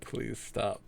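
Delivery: very soft, defeated plea; quiet pleading. Pretend. Co-host begging an end to the bit, quiet pleading. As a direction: beg weakly under your breath.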